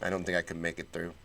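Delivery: ordinary talk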